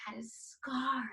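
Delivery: unvoiced whisper; hushed villain reveal; whispering Scar's name. Shown as a demonstration. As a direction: whisper his name like a secret.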